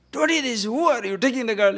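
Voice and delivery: hammed-up alarm of an old woman; old lady shouting. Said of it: "old lady started shouting".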